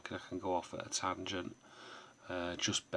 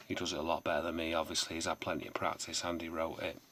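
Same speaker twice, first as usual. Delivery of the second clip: usual speaking voice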